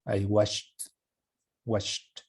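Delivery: Watched closing on a t sound; t ending. Shown as a demonstration. Teacher repeating Watched for students. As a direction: say Watched with a t at the end. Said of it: "Como si fuera una T".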